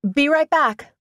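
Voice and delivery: high, breezy call-out; calling over shoulder. A narrator's reading. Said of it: "I called over my shoulder".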